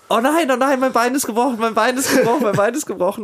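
replaying his scream after breaking his leg; retold pained yelling. Retelling the fall to the co-host, high loud mock wailing. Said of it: "Ich hab so geschrien".

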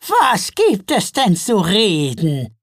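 grumpy troll mother, drawn out and loud. A narrator's reading. Troll mother answering Father Frost's offer to talk, grumpy. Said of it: "fragte Gaia mürrisch".

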